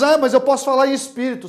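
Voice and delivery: high, loud, quick protest; acted objection. Imagined listener objecting to the preacher, loud quick protest. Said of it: "Aí você diz".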